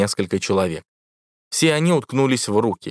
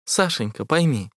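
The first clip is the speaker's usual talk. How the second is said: бархатный голос; 5 semitones higher than usual. A narrator's reading. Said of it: "голос снова стал бархатным".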